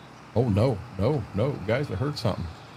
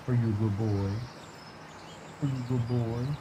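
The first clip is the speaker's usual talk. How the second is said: pet talk; 2 semitones lower, 8 dB quieter than usual. Real, not acted. Owner talking to his dog, soft cooing. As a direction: coo it like petting a puppy.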